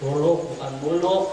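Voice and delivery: khuluq with a throaty kh, not buluk; say it right. Shown as a demonstration. Ustaz correcting the crowd, throaty khuluq. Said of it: "Ngomong khuluq".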